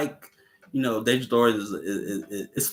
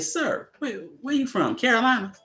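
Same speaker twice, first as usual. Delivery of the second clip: much higher, teasing question; high mock voice; 13 semitones higher than usual. Villain quizzing Carter on home, high mock voice. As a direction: squeak it out as a mocking villain. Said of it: "You said".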